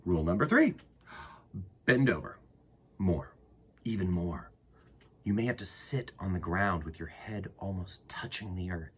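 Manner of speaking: put-on gentle read-aloud to children; storybook reading. Reads picture book aloud.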